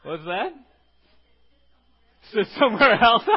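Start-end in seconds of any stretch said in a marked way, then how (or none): 2.3-3.2 louder joking retort through a laugh; laughing quip. Real, not acted. Pastor joking with the congregation, laughing loudly. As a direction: toss it off laughing.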